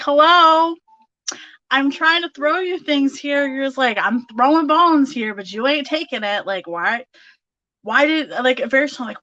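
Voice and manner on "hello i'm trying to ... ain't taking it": loud, higher 'hello?' of someone ignored; raised, pitched-up voice, comically fed up; mock exasperation. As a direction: call them out in playful disbelief. Voices exasperated spirit to sitter.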